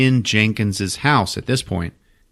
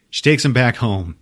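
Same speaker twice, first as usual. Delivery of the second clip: ordinary delivery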